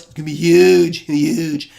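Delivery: Trump-style boast, drawn-out huge; presidential voice. Shown as a demonstration. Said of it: "Should I do it in a presidential voice?".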